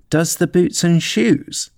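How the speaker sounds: high, slow puzzled question; wondering. A narrator's reading. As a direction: echo it in wonder, rising at the end. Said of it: "repeated in a wondering tone".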